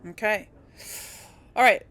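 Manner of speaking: usual speaking voice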